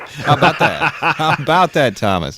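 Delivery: laughing through the words. Real, not acted. Cohost laughing over the caller's prize win. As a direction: chuckle it out, slow.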